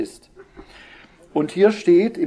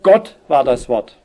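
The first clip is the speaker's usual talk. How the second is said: Gott said first and louder, the rest slow; stress on Gott; 4 dB louder than usual. Shown as a demonstration. Preacher showing the fronted word to his listeners, stress on Gott. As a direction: lean hard on Gott, then let the line fall slowly. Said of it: "weil es eben gerade die Betonung ist".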